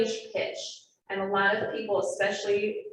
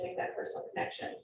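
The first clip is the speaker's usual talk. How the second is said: unvoiced, fading repeat; whispered trailing off; 10 dB quieter, faster than usual. Real, not acted. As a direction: let it fade to a low whisper. Looking at listeners one by one.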